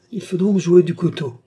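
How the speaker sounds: high, loud mocking voice; sneering taunt. A narrator's reading. Viscount taking up the knife challenge, sneering. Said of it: "ricana le vicomte".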